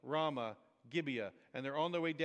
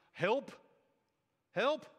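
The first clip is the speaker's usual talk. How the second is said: high, loud, short plea; cry for help; 6 semitones higher, 5 dB louder than usual. Acted. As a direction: call out for help with rising pitch.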